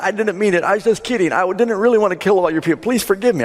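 high, hurried mock begging; desperate pleading. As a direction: babble excuses like a man begging for his life. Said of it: "starts to plead and beg desperately".

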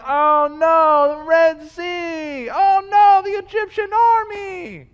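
high, stretched-out wail of dismay; mock freak-out. Preacher as Israelites at the Red Sea, mock panicking. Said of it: "they're freaking out again".

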